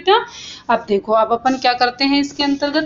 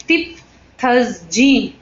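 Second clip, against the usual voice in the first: drawn-out suffix chant, syllable by syllable; slow rote listing of endings; slow recitation; much slower than usual. Shown as a demonstration. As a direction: recite each ending slowly for the class to copy. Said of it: "इस तरह से हमने क्या लगाए".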